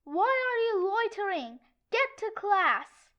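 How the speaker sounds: loud, scolding order; fierce hallway telling-off; honey badger voice. Shown as a demonstration. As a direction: tell off loiterers at full volume. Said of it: "that was the honey badger voice".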